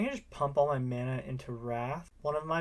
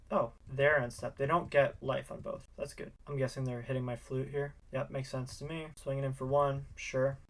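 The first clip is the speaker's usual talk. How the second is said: ordinary talk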